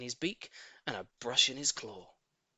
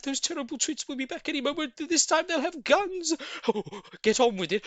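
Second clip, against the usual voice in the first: high, loud, frantic urging; frenzied excitement; 10 semitones higher, 8 dB louder than usual. A narrator's reading. Mugglewump hurrying the animals onto the furniture, frantic loud urging. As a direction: hurry them up in a wild frenzy. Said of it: "He was in a frenzy of excitement now".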